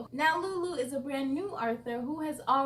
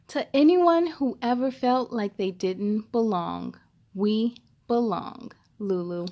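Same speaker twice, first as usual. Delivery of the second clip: louder storybook read-aloud; reading to children; 4 semitones lower, 6 dB louder, slower than usual. Acted. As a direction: read it out clearly for young listeners. Reads book dedication aloud.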